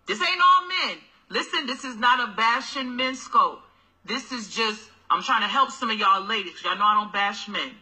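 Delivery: ordinary talk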